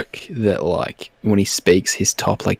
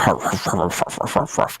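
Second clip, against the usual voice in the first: denture flapping sounds; 2 semitones lower, 4 dB quieter than usual. Retold or imitated. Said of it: "'cause the fucking denture's fallen out".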